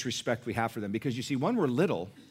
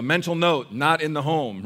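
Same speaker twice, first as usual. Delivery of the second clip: joking mental note; 4 semitones higher, 9 dB louder than usual. Retold or imitated. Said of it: "I told Angela".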